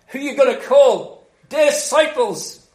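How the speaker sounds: loud, high advertising slogan; mock jingle call-out. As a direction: belt it out like a catchphrase. Mimics a film advertising jingle.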